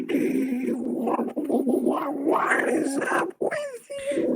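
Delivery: high, slow put-on woman voice; fake woman voice. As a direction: voice changer woman voice. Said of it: "the most natural female voice".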